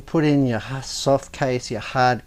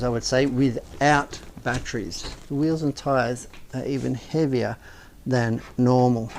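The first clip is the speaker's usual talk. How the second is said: ordinary talk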